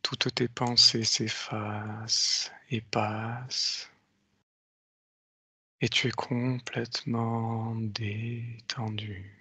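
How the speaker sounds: slow, soft hypnotic countdown; slow hypnotist voice. Acted. Leads a hypnotic induction. (voix lente d'hypnotiseur)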